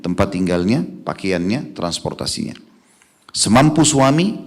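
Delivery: usual speaking voice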